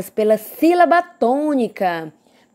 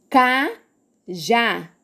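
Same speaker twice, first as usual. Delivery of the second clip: "Cajá" split as ca, já; syllable by syllable. Shown as a demonstration. Teacher giving the next example, "Cajá" said in separated syllables. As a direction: pause between the two halves of "Cajá". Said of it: "abriu e fechou a boca duas vezes".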